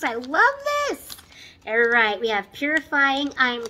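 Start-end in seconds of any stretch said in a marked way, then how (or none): 0.0-1.2 excited gush. Real, not acted. Gushing over favourite masks.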